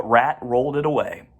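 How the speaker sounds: usual speaking voice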